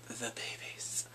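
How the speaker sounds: whispered reveal; hushed whisper. Real, not acted. Viewer revealing the twins, hushed whisper. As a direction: drop to a whisper for the surprise.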